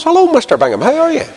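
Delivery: high-pitched Ballymena greeting; imitated Ballymena accent, high and chirpy. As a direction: do a wee Ballymena man, pitched well up. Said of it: "I'm not mocking a Ballymena accent".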